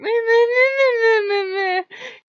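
very high, loud mimicked screech; high screechy note. Imitating Loki making his point, high screechy note. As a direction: imitate a screechy squeal, held long. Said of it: "he hits a high screechy note".

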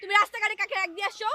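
high-pitched, indignant telling-off; angry scolding. Acted. Girlfriend scolding him for keeping her waiting, angry.

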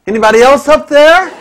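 high, loud shout with a laugh in it; comic desperate shout. Retold or imitated. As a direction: yell the punchline up the mountain. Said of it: "he shouts out".

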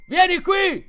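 very high, loud summons; yelled summons. A narrator's reading. Powell calling Speedy over, yelling. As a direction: yell it across a distance. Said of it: "urlò Powell".

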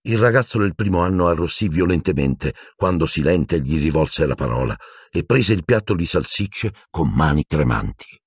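plain reading voice

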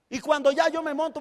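ordinary talk, nothing marked

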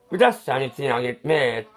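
normal talking voice

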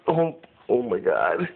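ordinary delivery

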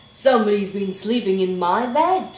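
loud, quick, booming complaint; indignant Daddy Bear. A narrator's reading. Daddy Bear finding his bed slept in, loudly indignant. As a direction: boom it out, affronted.

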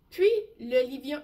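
usual speaking voice

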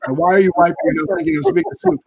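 high, quick incredulous asking; puzzled question. Pretend. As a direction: toss it up high, baffled and fast. Imagined puzzled question from listeners.

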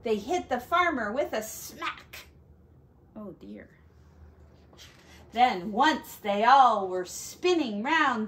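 plain reading voice

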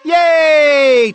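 high, loud long cheer. A narrator's reading. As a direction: cheer like a crowd of squirrels. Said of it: "cheered the Tennessee squirrels".

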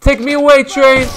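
high, loud cry to the train; desperate shout. Real, not acted. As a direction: yell for the train to rescue him. Fleeing onto the train.

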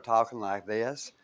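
imitated slow Southern drawl; Southern accent. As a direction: put on a Louisiana Southern twang, vowels stretched. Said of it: "fell in love with the Southern accent".

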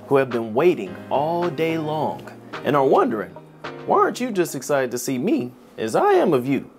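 usual speaking voice

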